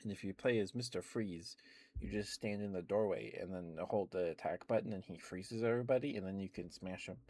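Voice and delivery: ordinary talk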